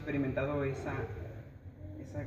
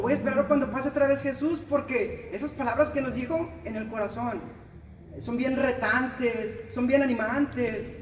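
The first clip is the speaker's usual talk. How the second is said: mock gushing delay; 7 semitones higher, 4 dB louder than usual. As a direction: parody a listener who only admires and never acts. Mocking listener who waits.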